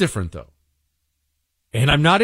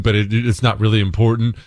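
ordinary talk

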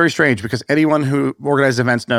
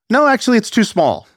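higher-pitched mock insistence of a dad; lightly voiced stubborn dad; 9 semitones higher than usual. Dad contradicting the airline clerk about bag size, mock insistent. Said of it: "And my dad responds".

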